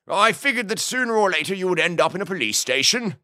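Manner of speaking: high-pitched, jovial lordly boss; Lord Huxley. A narrator's reading. Boss explains himself to Boone, jovial. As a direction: chatter on grandly in a high character voice.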